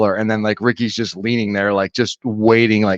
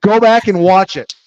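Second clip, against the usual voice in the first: mock-insistent, pitched up; raised role-play voice, digging in; playing an angry driver; 6 semitones higher, 4 dB louder than usual. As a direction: play-act an indignant challenge. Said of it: "I'm Ricky".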